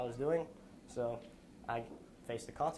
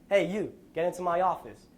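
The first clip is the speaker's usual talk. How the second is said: sharp, louder barked order, pitched up; a touch of a boss yelling across the room; retold yell; 4 semitones higher, 7 dB louder than usual. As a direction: shout it like a drill sergeant calling you in. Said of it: "I hear a voice yell at me".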